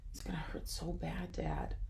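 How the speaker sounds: scared whisper; whispered fear. Acted. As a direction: whisper it, terrified of the pain. Scared son confides to father.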